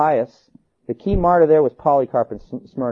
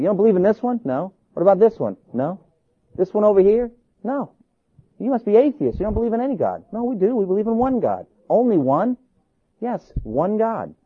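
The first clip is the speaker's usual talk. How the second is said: higher-pitched, animated back-and-forth; mock interrogation; 7 semitones higher than usual. Pagans quizzing Christians about their gods, animated and incredulous. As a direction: act out puzzled questions and simple replies.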